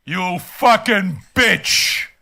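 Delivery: very slow and emphatic; contemptuous, spaced-out words; slow, deliberate insult. Real, not acted. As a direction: drag out each word with contempt. Insulting the commenter.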